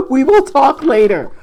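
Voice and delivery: loud, high, through laughter; high-pitched, loud, barely holding back laughter; laughing. Real, not acted. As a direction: call it out while cracking up. Mock reply amid fits of laughter.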